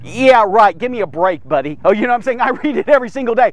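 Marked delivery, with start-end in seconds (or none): 0.0-1.8 sarcastic dismissal. Acted. Preacher answering people who claim Bible errors, dismissive. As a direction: scoff that sinks lower word by word. Scoffs back at the doubters.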